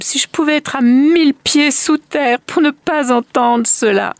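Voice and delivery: high, loud desperate wish; anguished cry. A narrator's reading. Woman wishes herself underground to escape the song, anguished. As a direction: wail it, desperate to escape the sound. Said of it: "s'écria la femme".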